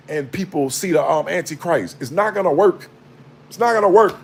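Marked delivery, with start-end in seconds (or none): 3.5-4.2 higher, louder insistent repeat; emphatic repeat. Real, not acted. Warning the church about end times, louder insistent repeat. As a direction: hammer the point home harder.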